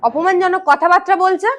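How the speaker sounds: loud, high-pitched outrage; indignant complaint. Acted. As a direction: demand that someone take your side, angrily. Complains to father-in-law about husband. (ক্ষোভে চড়া গলায় নালিশ)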